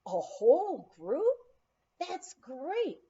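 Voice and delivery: eager, loud and slow on the question; light up at the news; excited. Retold or imitated. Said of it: "surprised at how excited he became".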